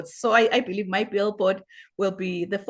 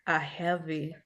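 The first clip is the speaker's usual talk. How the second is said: slow, soft, letting each phrase settle; soothing echo; 2 semitones lower, 7 dB quieter, slower than usual. Acted. Host echoes the guest's billboard words, slow and soft. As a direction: repeat the words gently, as if calming a friend.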